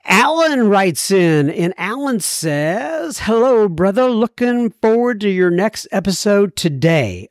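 sing-song. Acted. Host opening a listener's email, playful sing-song.

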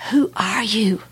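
a retold scream done as a breathless whisper; whispered cry. Woman in shock whispering her cry to God. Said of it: "I just screamed at God".

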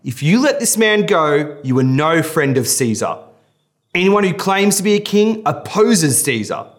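high, loud insistent shouting; leaders shouting. Acted. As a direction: hammer Pilate with the threat. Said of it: "kept shouting".

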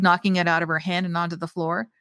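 usual speaking voice

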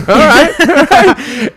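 high, loud laugh through the words; laughing approval. Retold or imitated. Guy won over by his joke, high laughing approval. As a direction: crack up while conceding.

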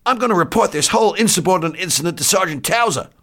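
high, loud, outraged protest; indignant threat. Acted. Doctor threatening to report insubordination, indignant. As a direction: threaten loudly at high pitch.

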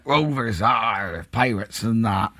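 lightly put-on slow pirate drawl; hint of pirate. Teasing rival Rovers as pirates, mock pirate drawl.

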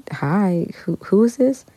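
baffled, caught off guard; surprised. Retold or imitated. Unknown caller, retold confusion.

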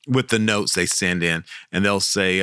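ordinary delivery

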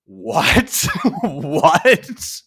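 high, loud laughing disbelief; baffled what. Real, not acted. Host reacting to the abrupt ending, loud laughing disbelief. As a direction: ask it twice, laughing in shock.

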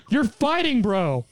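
high-pitched incredulous outburst; exasperated. Real, not acted. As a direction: scold the villain in high-pitched disbelief. Own exasperated outburst at the villain.

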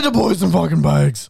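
loud, high mock demand. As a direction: shout it like a greedy demand. Mocking repeat, loud.

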